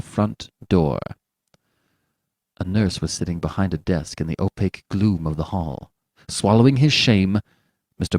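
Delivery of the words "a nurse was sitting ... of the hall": ordinary delivery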